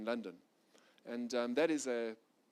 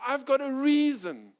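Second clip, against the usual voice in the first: much higher, louder, heated "I've got a reason"; loud insistence; 10 semitones higher, 9 dB louder than usual. Retold or imitated. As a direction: push "I've got a reason" hard as a proud claim. Pride's loud justification.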